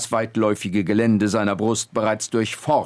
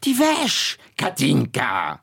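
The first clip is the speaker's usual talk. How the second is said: put-on scolding old woman; slower than usual. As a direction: demand the laundry right at her side. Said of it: "trat dicht neben Katharina Knack und rief".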